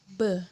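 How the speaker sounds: B as a short buzzing "beh" with lips pressed shut; voiced B sound. Shown as a demonstration. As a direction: say B with both lips closed, throat vibrating. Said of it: "kedua bibir ditautkan". (bunyi B, kedua bibir ditautkan, tenggorokan bergetar)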